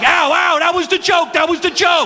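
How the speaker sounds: high, loud yelping protest; pained yelp. Retold or imitated. Patient yelping at the doctor mid-exam, high and loud. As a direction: yelp it out in mock pain.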